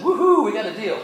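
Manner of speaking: high, loud celebratory whoop; mock rejoicing. Preacher jokingly misquoting the brothers' reaction, mock rejoicing. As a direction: whoop it up like a lucky winner. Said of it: "Then their hearts rejoiced and said".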